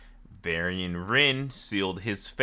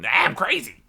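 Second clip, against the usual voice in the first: crazy voice; 8 semitones higher than usual. Retold or imitated. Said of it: "he's, like, a crazy guy".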